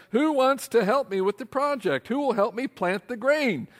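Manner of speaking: a busy hen recruiting helpers; lightly voiced hen. Hen asking the farm animals for help, high and eager.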